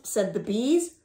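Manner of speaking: usual speaking voice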